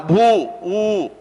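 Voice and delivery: "భూ" held long on its oo vowel; drawn-out u ending. Shown as a demonstration. Teacher isolates the final vowel, long oo in "భూ, ఊ". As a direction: stretch "భూ", then the bare "ఊ". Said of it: "ఆ శబ్దం ఉకారాంతం".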